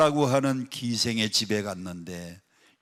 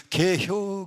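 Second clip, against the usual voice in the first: clipped Chungcheong 개혀, a touch higher; Chungcheong dialect; 5 semitones higher, much slower than usual. Retold or imitated. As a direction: answer short in Chungcheong dialect. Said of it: "충청도 사람들은요".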